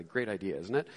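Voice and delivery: dry, dropped sarcastic aside; low and dry, mock praise; quiet sarcasm. Real, not acted. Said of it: "sarcastic aside on idea".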